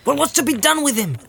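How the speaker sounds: high-pitched indignant servant; indignant Sam. Acted. Sam asking his master about the captive, high indignant voice. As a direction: demand an answer, pitched well up.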